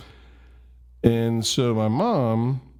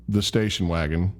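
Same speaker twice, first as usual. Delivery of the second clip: ordinary delivery, nothing marked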